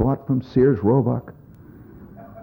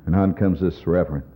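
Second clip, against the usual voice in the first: ordinary delivery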